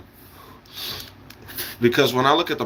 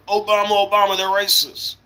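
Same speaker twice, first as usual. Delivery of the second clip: high, raised mock outcry; mock complaining; 9 semitones higher than usual. Mimicking political opponents crying racism, high mock outcry. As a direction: mimic a whining accuser, pitched up high.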